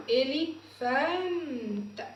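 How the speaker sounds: drawn-out teaching pronunciation of إليفانت; slow sounding out. Shown as a demonstration. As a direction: stretch إليفانت slowly, syllable by syllable. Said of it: "teacher sounds out word slowly".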